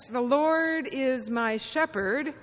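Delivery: very slow, measured sample reading; slow scripture reading. Shown as a demonstration. Preacher showing congregation how the reading sounds, very slowly. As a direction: read it out slowly, word by word. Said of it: "it may sound something like this".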